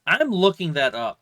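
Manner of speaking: quick loud promise. Real, not acted. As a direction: burst out that you're on it. Scrambling to find game name.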